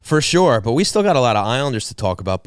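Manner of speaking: usual speaking voice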